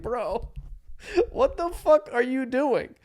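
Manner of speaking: high, slow mock exasperation; retold baffled protest; incredulous. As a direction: draw it out in disbelief at your friend. Retold disbelief at friend's fake act.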